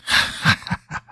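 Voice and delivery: low creaky, voiceless cackle; slow staccato demon chuckle; guttural demon laugh. A narrator's reading. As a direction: laugh in a dry demonic rattle. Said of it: "voice coming from the girl was low, guttural".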